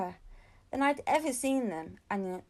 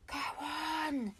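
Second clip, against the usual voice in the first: softer, gently urging whisper; a girl softly egging her friend to open the letter; 5 semitones higher, 6 dB quieter than usual. A narrator's reading. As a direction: nudge your friend on quietly. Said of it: "whispered Josie".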